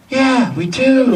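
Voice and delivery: slow, very high Southern woman; put-on Southern admission, stretched out; imitated southern accent. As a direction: drawl it high, as the Southern girl. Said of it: "she had this southern accent".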